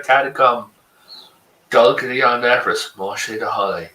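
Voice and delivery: low fake Irish accent gibberish. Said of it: "Say something in Irish for me".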